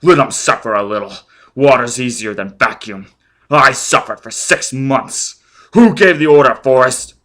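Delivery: vengeful man shouting at victim; cruel fury. Acted. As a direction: savor his pain, then bark. Foyle cruel and furious.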